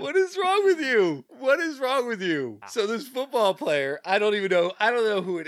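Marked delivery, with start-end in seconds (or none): 0.0-2.6 high, drawn-out disbelief; incredulous outcry. Real, not acted. Host scolding football player in clip, incredulous outcry. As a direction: pitch it high and stretch the question.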